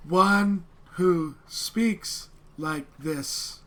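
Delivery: very slow, each word drawn out; very slow broken Common from a dragonborn child; super slow broken Common. Acted. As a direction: stretch every word for the outsider. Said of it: "speaking in super slow Common".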